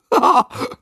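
loud, high burst of laughter; short incredulous laugh, pitched high; boy's laugh. A narrator's reading. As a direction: burst out laughing like an amused boy. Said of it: "Noah musste lachen".

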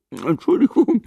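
high, giggly apology; boy giggling as he speaks, pitched high. A narrator's reading. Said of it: "fügte er kichernd hinzu".

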